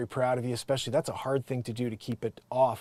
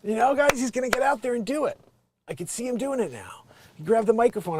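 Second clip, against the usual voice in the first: normal talking voice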